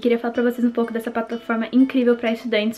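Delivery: upbeat sponsor read. Acted. Sponsor read before the vlog.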